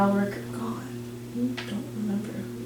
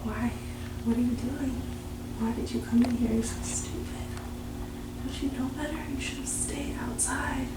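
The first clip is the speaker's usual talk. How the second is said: scolding figure, hushed; 3 semitones higher, 5 dB quieter than usual. Acted. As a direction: whisper a disappointed telling-off.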